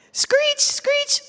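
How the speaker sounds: very high, loud screech; monkey screech. Shown as a demonstration. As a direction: shriek like a wild monkey. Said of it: "like a monkey".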